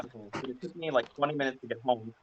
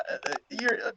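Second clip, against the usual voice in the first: flustered stammer; 9 semitones higher, 3 dB louder than usual. Retold or imitated.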